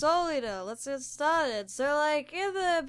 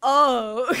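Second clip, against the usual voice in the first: much louder, punched out "Oh"; sudden realization; 10 dB louder than usual. Pretend. Artist stunned by his finished drawing, loud sudden "Oh". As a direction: blurt it, suddenly struck.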